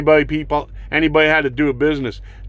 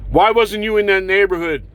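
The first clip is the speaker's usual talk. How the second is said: usual speaking voice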